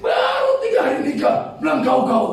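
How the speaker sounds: high, loud indignant complaint; imitated angry wife. Offended wife scolding new husband over "kau", mock angry outburst. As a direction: shout it like an offended newlywed. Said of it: "dia marah".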